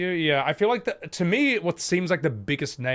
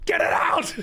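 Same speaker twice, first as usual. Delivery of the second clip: shouting at the screen; 14 semitones higher than usual. Real, not acted. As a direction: shoo it away, screaming. Yelling at the screen.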